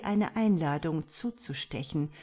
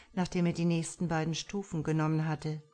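usual speaking voice

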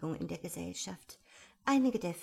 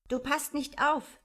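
higher, louder scolding; stern; 7 semitones higher, 6 dB louder than usual. A narrator's reading. Die Maus scolds Alice for not listening, stern. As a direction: reprimand an inattentive listener sternly. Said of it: "sagte die Maus strenge zu Alice".

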